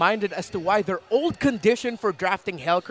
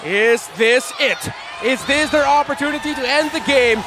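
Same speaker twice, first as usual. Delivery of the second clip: loud, rising hype call; esports caster; 6 semitones higher, 9 dB louder than usual. Acted. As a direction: shout the big moment, building. Live climax call.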